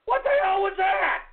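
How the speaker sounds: outraged radio host shouting; exasperated shout. Real, not acted. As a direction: bellow the question at the caller. Host yells at a prank call.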